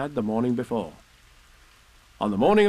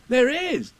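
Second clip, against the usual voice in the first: put-on high-pitched boyish agreement; eager boy; 13 semitones higher, 4 dB louder than usual. A narrator's reading.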